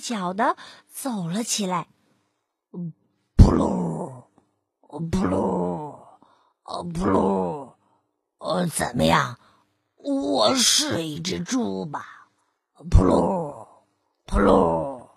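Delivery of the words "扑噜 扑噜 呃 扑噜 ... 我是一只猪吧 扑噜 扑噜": low, grunting fake oinks; low mock pig grunts, unsure; wolf faking pig grunts. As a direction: grunt like a pig to pass as one. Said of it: "老狼一边叫着".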